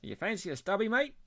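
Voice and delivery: put-on light Aussie accent, rising at the end; Australian accent. Showing off beer slang to co-host, Australian accent. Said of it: "One accent you can do".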